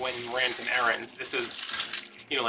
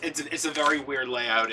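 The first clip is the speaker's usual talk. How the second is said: ordinary delivery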